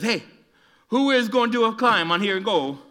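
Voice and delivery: put-on loud, high Italian-accented call. Boy [name] egging on his friends, Italian accent. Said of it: "That's my Italian accent".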